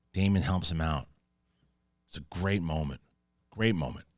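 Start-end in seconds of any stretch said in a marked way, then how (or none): none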